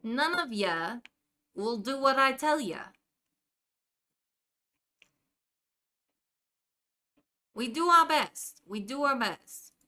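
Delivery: put-on Jersey accent. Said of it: "You didn't even know there was a Jersey accent".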